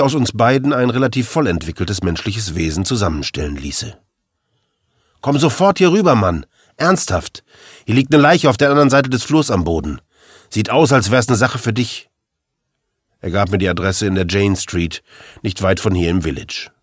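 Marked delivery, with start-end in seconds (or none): 5.2-12.1 higher-pitched, insistent call to come over at once; urgent summons. Acted. McGovern calling the narrator to a corpse, urgent. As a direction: press him urgently, voice jumping up.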